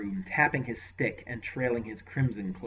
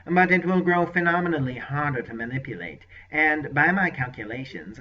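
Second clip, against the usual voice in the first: raised, lightly oily sorcerer voice; voiced sorcerer; 4 semitones higher, 7 dB louder than usual. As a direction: play the scheming sorcerer, mild. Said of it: "seemed to hesitate".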